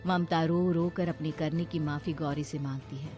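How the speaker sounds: plain reading voice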